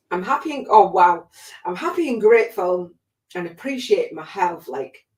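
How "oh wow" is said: ordinary talk, nothing marked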